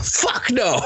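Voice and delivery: high mock defiant shout. Both men refusing her, high mock reply. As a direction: fire it back together.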